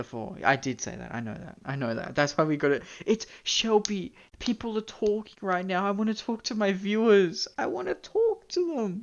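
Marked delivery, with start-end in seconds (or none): 3.0-9.0 mock whining. Streamer protests to Shelby about talking to viewers, mock whining. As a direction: plead like a put-upon child.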